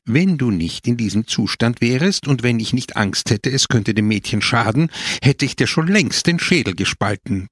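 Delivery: plain reading voice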